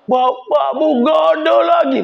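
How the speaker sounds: high put-on wail of "Bapakmu gak ada lagi"; mock wailing. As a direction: ham up a grieving relative's cry. Mocking relative's wailing news. (ratapan pura-pura yang tinggi)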